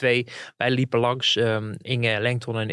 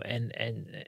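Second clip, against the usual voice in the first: ordinary talk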